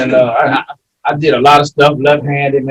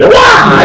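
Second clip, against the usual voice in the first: sudden high shouted karate cry; karate yell; 7 dB louder than usual. Retold or imitated. Man retelling the street fight to his friends with a karate yell.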